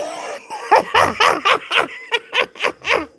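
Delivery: shrieking laugh. Real, not acted. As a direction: let out a shrieking cackle. Bursts out laughing at own theory.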